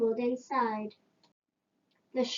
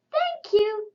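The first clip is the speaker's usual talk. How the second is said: grateful giraffe; 9 semitones higher, 4 dB louder than usual. A narrator's reading. Giraffe thanks George.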